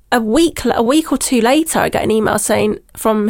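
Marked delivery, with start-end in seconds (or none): none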